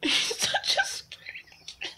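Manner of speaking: squeaky breathless laughing words, slow; giggling at the frightened cat. Real, not acted. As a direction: talk through giggles.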